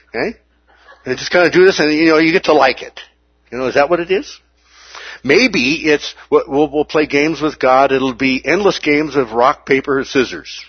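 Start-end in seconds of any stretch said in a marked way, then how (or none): none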